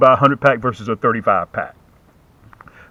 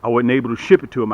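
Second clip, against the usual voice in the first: normal talking voice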